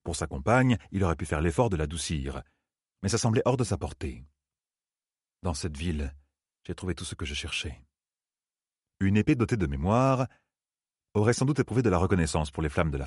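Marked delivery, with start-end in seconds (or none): 5.4-7.9 hushed, low-key and detached; distant, impersonal. A narrator's reading. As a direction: state it quietly, without involvement. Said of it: "la voix de Rand était distante et impersonnelle". (voix basse, distante et impersonnelle)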